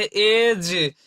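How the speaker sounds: slow, drawn-out एज; teacher spelling out एज slowly; slow example word. Shown as a demonstration. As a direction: stretch एज out for the class. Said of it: "जैसे".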